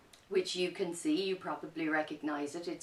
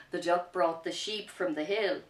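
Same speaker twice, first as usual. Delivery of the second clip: lively picture-book narration, a bit louder; reading to children; 7 dB louder than usual. A narrator's reading. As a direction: tell the story with energy for kids.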